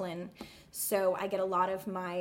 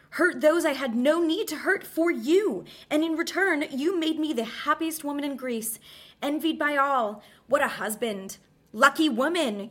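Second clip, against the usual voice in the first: bitter mockery; 7 semitones higher, 6 dB louder than usual. Sarcastic climax of monologue.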